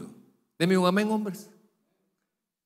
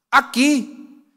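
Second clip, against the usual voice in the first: high, sugary little "aquí"; mock pious answer; 9 semitones higher, 8 dB louder than usual. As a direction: play the fake saint sweetly. (respuesta piadosa fingida, aguda)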